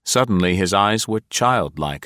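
ordinary delivery

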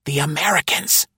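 high, hissed under the breath. A narrator's reading. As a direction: hiss the name like a secret. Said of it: "he hissed".

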